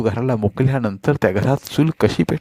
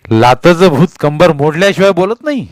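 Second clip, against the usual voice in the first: loud, higher vicious threat; cruel threat; 5 semitones higher, 5 dB louder than usual. A narrator's reading. Husband kicking his wife, cruel loud threat. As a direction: spit it as he kicks her. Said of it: "तो म्हणाला".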